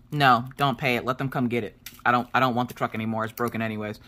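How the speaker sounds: low, fast, fed-up retort; frustrated. Retold or imitated. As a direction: brush him off, exasperated. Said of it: "I was frustrated".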